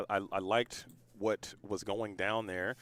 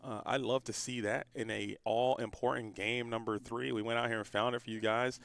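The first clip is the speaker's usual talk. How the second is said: ordinary talk, nothing marked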